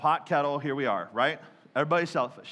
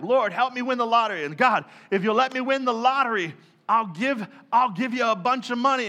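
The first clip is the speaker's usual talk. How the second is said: mock lottery prayer; 5 semitones higher, 7 dB louder than usual.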